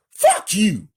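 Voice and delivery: high, loud yell in defiance. Acted. Wounded villain yells at Frank, defiant, loud. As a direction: spit it out at full volume, defiant. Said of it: "Stain yells".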